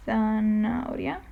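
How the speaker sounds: "zanahoria," slowly, as thana-oria with no H; silent H. Shown as a demonstration. Teacher giving the example word, slow "zanahoria," with silent H. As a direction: draw out "zanahoria," leaving the H unspoken. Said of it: "you just don't read it".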